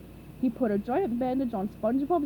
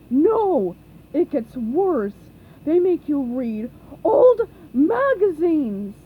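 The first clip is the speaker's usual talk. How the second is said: dramatic loud Patrick; 4 semitones higher, 11 dB louder, slower than usual. A narrator's reading.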